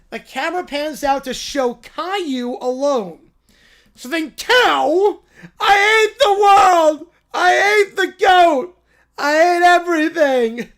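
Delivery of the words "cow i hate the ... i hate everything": put-on high, loud, sobbing child shout; crying tantrum kid. Caillou alone on the farm, tearful tantrum shout. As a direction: scream it through tears like a tantrum.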